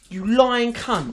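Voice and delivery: angry inner rant. Retold or imitated. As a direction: spit it out slowly with contempt.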